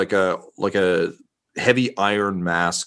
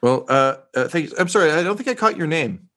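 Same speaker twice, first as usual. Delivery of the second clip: lightly voiced reporter, hesitant then higher; awkward reporter; 9 semitones higher, faster than usual. Reporter awkwardly asking an officer for a name, hesitant and lifted in pitch. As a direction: stumble into a polite apology.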